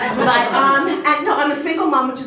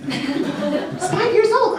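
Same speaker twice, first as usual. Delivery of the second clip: mock disbelief; 7 semitones higher, much slower than usual.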